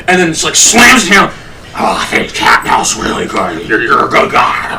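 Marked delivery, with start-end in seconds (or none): 1.7-4.8 imitating a low, wrecked croak; spent, croaky voice. Drinker praising the captain after screaming, low wrecked croak. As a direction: rasp it out low, half without voice.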